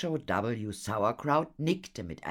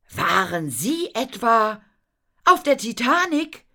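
high, loud, climbing higher; amazed question; 11 dB louder than usual. Acted. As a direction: ask it wide-eyed, building to a peak. Children astonished by the revelation. (staunend, immer höher)

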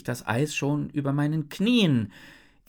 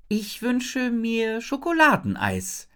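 solemn; 9 semitones higher than usual. A narrator's reading. Isabel makes her wish to Jin, solemnly. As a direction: intone it like a ceremonial wish. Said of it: "sage ich feierlich".

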